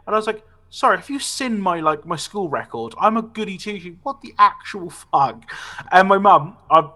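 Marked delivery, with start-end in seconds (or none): 0.7-5.4 higher-pitched outraged innocence; mock indignant protest. Pupil to headmaster on being expelled, mock indignant. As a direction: act the wronged goody two-shoes.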